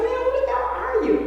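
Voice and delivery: high, fast, mock-exasperated retort; exasperated disbelief. Telling the stranger at her door off, in high, fast mock exasperation. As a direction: throw a startled, annoyed question at the stranger. Retold annoyed reply at door.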